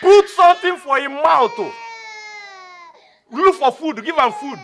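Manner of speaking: loud, high, fed-up demand; exasperated order. Acted. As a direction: raise your voice over the crying baby. Guard fed up with crying baby.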